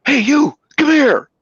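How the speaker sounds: high, loud mock tough-guy bark; playing the angry truck driver; mean guy. Retelling the angry driver, high mock threatening voice. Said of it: "He's really mean".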